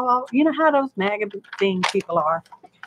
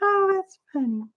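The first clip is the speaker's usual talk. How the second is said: high, soft voice after laughing; real amusement, pitched up and softer; tickled; 10 semitones higher, 6 dB quieter than usual. Real, not acted. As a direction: sigh it out as the laughter fades. Recovering from laughing.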